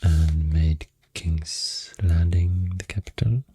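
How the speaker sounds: ordinary talk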